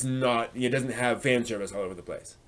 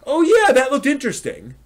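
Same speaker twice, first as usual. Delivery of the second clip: higher, louder mock enthusiasm; a bright, hammed-up "oh yeah"; imitating an eager newbie; 12 semitones higher, 7 dB louder than usual. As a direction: play the curious friend who's keen. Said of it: "If they're like".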